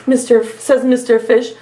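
ordinary delivery

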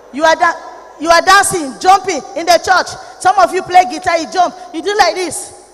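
loud, high preaching shout; fiery preacher yelling at youth; shouting rebuke. Real, not acted. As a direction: bellow it at the congregation.

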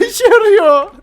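excited. Real, not acted. Laughing, frantic over buttons.

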